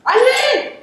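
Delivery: high-pitched call to get moving; high, insistent command; urgent urging. Acted. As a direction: call it out high to spur him on. Urging John on with the rope.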